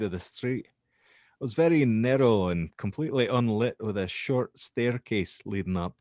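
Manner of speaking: lightly put-on Scottish accent as the witness; an understated Scottish brogue. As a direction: tell it as a Scottish student, casual Scottish accent. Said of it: "I'm gonna do a frickin' Scottish accent".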